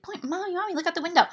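put-on little girl voice. Little girl on the plane calling her mommy, high and childlike. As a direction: squeak it like an excited small child. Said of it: "she's putting her fingers right in my face".

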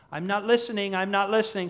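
high, loud refusal to hear; protesting Smeagol. Acted. Preacher as Smeagol shutting Gollum up, protesting. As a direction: drown the other voice out. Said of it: "to tell Gollum to shut up".

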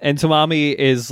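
normal talking voice

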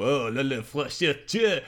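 hammed-up pretend French, rising to a high squeak; mock French. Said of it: "if you wanna pretend to speak French, you go".

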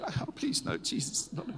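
high, breathy mock plea from the couple; mock protest. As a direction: play the couple's horrified, joking refusal. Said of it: "And they're going".

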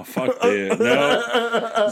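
cracking up laughing. Real, not acted. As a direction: laugh through the words. Joe cracking up mid-apology.

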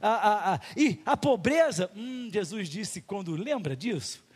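ordinary delivery

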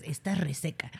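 soft, low quoted jab; mock put-down. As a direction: drop low and quiet to imitate the insult.

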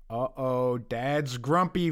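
low, slow mock warning; imitated uh-oh aside; wary kids' aside. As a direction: drop it low like a warning passed along. Children's reaction to dad voiced.

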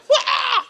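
a sudden very high mock wail; hammed-up sobbing cry; imitated crying wail. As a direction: wail like a woman bursting into tears. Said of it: "goes out screaming and crying".

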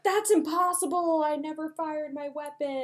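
high-pitched mock denial; play-acted indignant disbelief; protesting accused cop. Acted denial to police chief.

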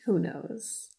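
quietly tossed-off aside; hushed aside. Real, not acted. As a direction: drop it right down under the other voice. Hushed aside under co-host's question.

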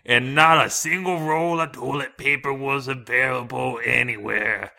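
loud, creaky grandpa storyteller; old man voice. Retold or imitated. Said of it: "it's my old man voice".

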